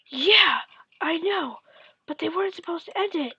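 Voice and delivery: high, whiny objection; imitated childish protest. Kid arguing with dad over the ending, whiny mock protest.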